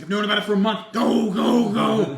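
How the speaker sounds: high, very loud urging shout; excited yelling. Retold or imitated. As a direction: yell it to hurry him along. Retold dad urging son on.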